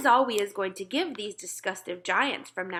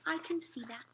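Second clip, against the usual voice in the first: whispered aside; 6 semitones higher, 9 dB quieter than usual. Acted. Queen trails to whisper.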